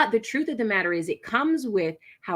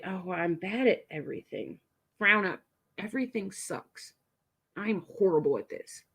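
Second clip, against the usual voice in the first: looking down, moping much softer; downcast self-talk; 6 dB quieter than usual. Shown as a demonstration. Coach demonstrating slumped negative self-talk, mopey and soft. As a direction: mutter defeat at the ground. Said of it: "Look down at the ground and say".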